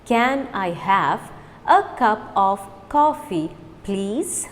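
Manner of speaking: slow, careful model; slow repeat. Shown as a demonstration. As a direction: say each word slowly for learners. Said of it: "Now repeat with me fast".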